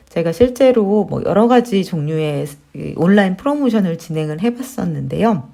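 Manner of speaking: ordinary talk, nothing marked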